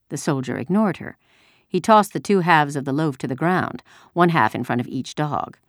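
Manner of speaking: usual speaking voice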